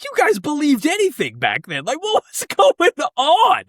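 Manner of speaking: mock outrage at the past; incredulous. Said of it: "I was like".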